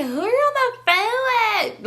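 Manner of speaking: put-on high, drawn-out drunken whine; mock drunk girl. Drunk-acting girl butting into the call, exaggerated imitation. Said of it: "acting like she was so drunk".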